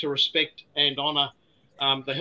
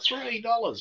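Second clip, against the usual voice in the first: higher, imitating an outraged customer; mock outrage; 8 semitones higher, 3 dB quieter, slower than usual. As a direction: echo the price as if offended, mildly hammed. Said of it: "he went crook".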